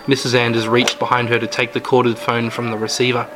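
ordinary delivery, nothing marked

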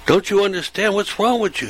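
imitating a frantic mild yell; retold agitated yell. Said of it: "he yells out".

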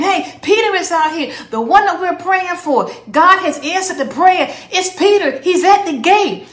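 high, loud, bursting with excitement; excited servant girl. Retold or imitated. Servant girl Rhoda tells the praying household Peter is at the gate, excited and loud. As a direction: run in shouting the good news. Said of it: "She was all excited".

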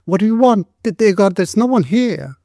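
high, stammering frightened voice; scared woman's voice, pitched well up; terrified. A narrator's reading. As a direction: answer in fright from behind a locked door. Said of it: "said this terrified voice".

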